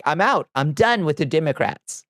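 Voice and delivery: James Carville. Acted. Fed-up strategist quitting the party, bad Louisiana accent. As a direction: have a rough go at a Louisiana accent. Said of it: "I can't do a good Louisiana accent".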